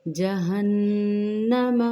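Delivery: जहन्नम with a long nasal hum on the doubled noon; full ghunna. Shown as a demonstration. Teacher shows the full ghunna in जहन्नम slowly. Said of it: "नून मुशद्दद पे पूरा मुकम्मल गुन्ना करेंगे".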